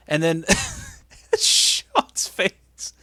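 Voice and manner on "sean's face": high words squeezed out through laughter; real laughing. Real, not acted. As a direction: crack up as you say it. Cracks up at Sean's face.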